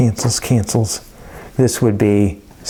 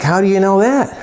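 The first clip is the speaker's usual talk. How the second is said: challenging question; 8 semitones higher than usual. Retold or imitated. Teacher challenges student in story.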